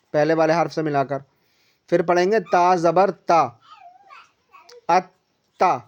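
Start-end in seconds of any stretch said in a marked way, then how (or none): 4.9-5.8 ات then a clear pause before تا, doubling the ت; shadda with a pause. Shown as a demonstration. Teacher shows the doubled ت with a pause. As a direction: split ات and تا with a short stop. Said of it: "ذرا رک کر پڑھیں".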